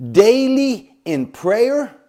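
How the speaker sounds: daily called out high, loud and slow; emphatic daily. Acted. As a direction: proclaim daily in prayer. Preaching point two.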